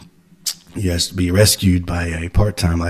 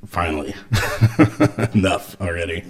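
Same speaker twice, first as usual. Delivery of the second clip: laughing; 4 dB quieter than usual. Retold or imitated. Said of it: "I just thought".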